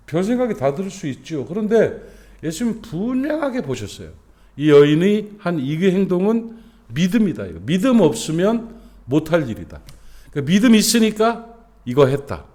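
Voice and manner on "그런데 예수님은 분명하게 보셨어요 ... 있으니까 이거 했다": higher, louder fervent preaching; full-force preaching. Acted. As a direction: drive the point home to the congregation.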